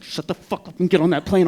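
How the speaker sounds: imitating a fed-up girlfriend; angry girlfriend's order. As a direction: order your boyfriend sharply aboard. Girlfriend orders him onto plane.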